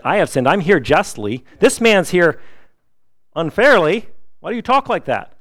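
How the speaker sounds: loud, rising into a high-pitched protest; earnest rebuke. Retold or imitated. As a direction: build from firm confession to high, indignant challenge. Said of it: "He was confessing his sin".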